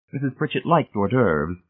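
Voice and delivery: usual speaking voice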